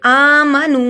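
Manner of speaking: "آمنو" with long opening aa and long final uu; elongated vowels. Shown as a demonstration. Teacher showing one-alif length, "آمنو" with drawn-out vowels. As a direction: hold both ends of "آمنو" for a beat. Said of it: "ایک الف کے برابر لمبا ہوگا".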